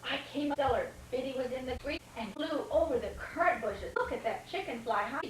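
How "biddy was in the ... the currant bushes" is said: usual speaking voice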